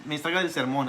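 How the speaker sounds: put-on high, fast, irritated order to remove the child; impatient pastor. Old pastor ordering a parent to take out a crying child, mock impatient. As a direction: rattle it off like a grumpy preacher. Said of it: "O si un niño está llorando".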